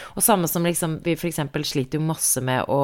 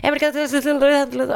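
talking fast; 10 semitones higher, slower than usual. Retold or imitated. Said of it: "så jeg bare sånn".

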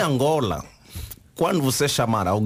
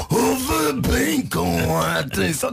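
menacing; 5 semitones higher, much slower than usual. Retold or imitated. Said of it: "veem a cara do indivíduo".

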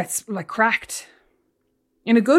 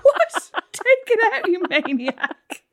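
much higher, laughing mock outrage; laughing outburst; 7 semitones higher, 4 dB louder, much slower than usual. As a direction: squeal it through laughter. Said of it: "I was like".

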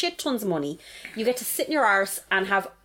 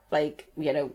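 normal talking voice

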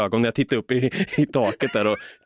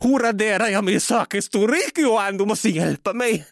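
high, frantic plea in a much higher voice; panicked; 9 semitones higher than usual. Retold or imitated. Boy begs his IT-savvy friend Johan for help, panicked and high. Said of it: "i panikartad ton bara".

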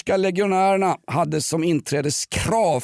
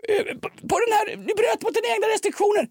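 much higher pitched, hammed-up yelling; mock yell; faster than usual. Podcaster mimics Thyberg yelling at Carlsson, high mock yell. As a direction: squeal it out like an outraged boss. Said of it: "skriker han Thyberg".

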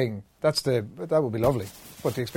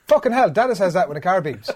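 raised, louder mimicry of a small child; retold kid's voice, pitched up; imitated little boy; 6 semitones higher, 6 dB louder than usual. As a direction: play the child cheerfully repeating Dad's swearing. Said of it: "he was just tipping along on his little bike".

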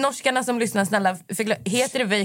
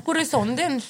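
higher, mock boy's question; mock drunk boy; 8 semitones higher than usual. S1 retells the boy. (härmar pojken, högre)

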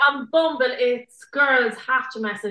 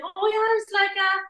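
higher, hesitant polite reply; awkward politeness; 6 semitones higher, 4 dB quieter than usual. Retold or imitated. Woman retelling her reply to a half-remembered man, awkwardly polite. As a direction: play along uncertainly, pitched up.

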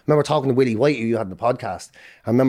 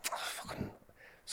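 1 whispered aside; 22 dB quieter, slower than usual. Retold or imitated. Remembering leaving hospital.

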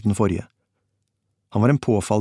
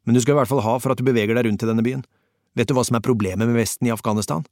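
fast rant; 2 semitones higher, faster than usual. Acted. German rants at narrator.

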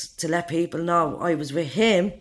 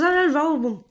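eager offer; 10 semitones higher than usual. Retold or imitated. Man offering to roll joint.

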